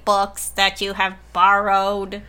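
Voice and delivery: slow automated robocall voice; robot voice. Pretend. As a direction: read it like a robocall. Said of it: "automated robot voice phone calls".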